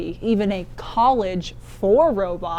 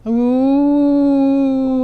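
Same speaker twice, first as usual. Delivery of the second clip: long spooky ghost moan; haunting ooh like a ghost; 3 semitones higher, 8 dB louder than usual. Pretend. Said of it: "Practicing my ghost impression".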